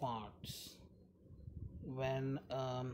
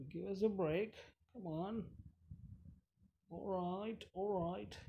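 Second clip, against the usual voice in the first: high-pitched, hammed-up British accent; fake British accent; 8 semitones higher than usual. Player pleading with zombie monster guy, mock British accent. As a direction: put on a bad British voice, pitched up. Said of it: "That's my fake, uh, British accent".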